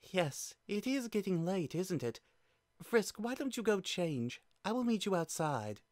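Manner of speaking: nervous Toriel. Acted. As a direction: cover unease with brightness. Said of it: "With a nervous giggle".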